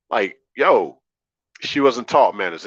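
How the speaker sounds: ordinary delivery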